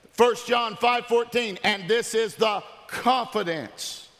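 high, emphatic scripture declaration; full-force preaching. Real, not acted. Pastor declaring confidence from scripture, preaching emphatically.

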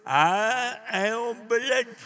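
drawn out at half speed, slightly lifted; slow refrain. Shown as a demonstration. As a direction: stretch the refrain wide. Repeated refrain of his story.

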